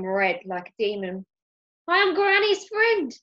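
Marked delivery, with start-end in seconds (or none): 1.9-3.1 high, loud flustered blurt; spluttering. A narrator's reading. Ben explains himself to Mister Parker, flustered splutter. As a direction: blurt it out in a panic. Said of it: "spluttered Ben".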